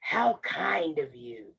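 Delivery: low, slow wolf voice; drawn-out false courtesy; deep and slow, flattering the child; sly wolf. A narrator's reading. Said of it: "the wolf said".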